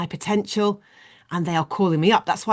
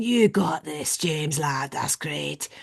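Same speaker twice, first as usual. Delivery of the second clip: lower, easing-off Scottish encouragement; Scottish accent; 4 semitones lower, 4 dB quieter, much slower than usual. Retold or imitated. Scottish guy calling James up, encouraging Scottish accent. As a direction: back him in a put-on Scottish voice. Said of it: "He was saying".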